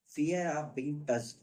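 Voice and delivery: each word spaced out slowly; slow, deliberate rubric read; slow dictation. Shown as a demonstration. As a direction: dictate it so students can write. Teacher dictating a rubric name.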